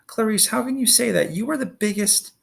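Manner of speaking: high, indignant outburst; exploding at Clarice. Acted. Annabeth defending Percy against Clarice, high and indignant. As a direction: snap back at her hotly. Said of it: "Annabeth exploded".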